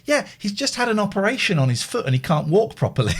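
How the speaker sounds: high-pitched imitation of a mum; school mum. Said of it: "She went".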